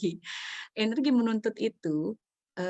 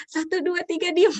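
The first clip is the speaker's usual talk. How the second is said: high, loud mock countdown; mother's warning count; 11 semitones higher, 9 dB louder than usual. Mother counting at crying child, loud mock warning. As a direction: count like a fed-up mom issuing a threat. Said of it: "Nah, ibu hitung nih".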